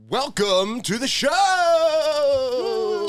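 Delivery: high, slow, loud show opener; announcer shout. Acted. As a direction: belt out the welcome. Podcast opening.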